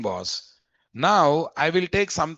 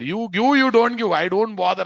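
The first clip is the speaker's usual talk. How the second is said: voicing the method; 7 semitones higher, 4 dB louder than usual. Voicing the Supports attribute.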